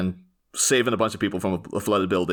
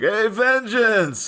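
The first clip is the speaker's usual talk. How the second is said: mocking high, drawn-out call; Penguin; 13 semitones higher, 4 dB louder, much slower than usual. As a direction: taunt him with a stretched, high-pitched greeting. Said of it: "the Penguin always mockingly refers to him".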